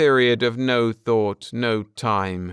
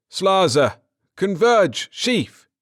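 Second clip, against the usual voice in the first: higher, louder barked orders; shouted commands; 10 semitones higher, 5 dB louder, slower than usual. Acted. Captain shouting orders to his battery crews, loud and urgent. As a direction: snap out the orders like a whip.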